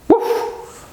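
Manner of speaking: breath-heavy woof; dog woof. Pretend. Teacher showing what dogs do, dog woof. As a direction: huff out a bark.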